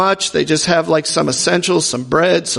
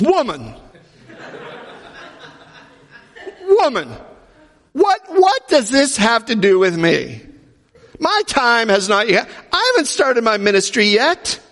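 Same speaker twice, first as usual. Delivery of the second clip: a hammed-up, higher dismissive "Woman, woman"; mock Jesus reply; 5 semitones higher than usual. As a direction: play Jesus brushing his mother off, half-joking, voice pitched up. Jesus answering his mother, mocked.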